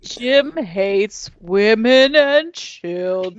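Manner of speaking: very slow, stretched words; slow-mo replay. Pretend. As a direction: drag each word out like slow motion. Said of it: "Let's see what Jim said in slow-mo".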